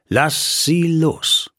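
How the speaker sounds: slow, steady order; calm command. Acted. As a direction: calmly insist she be released. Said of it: "entgegnete er ruhig".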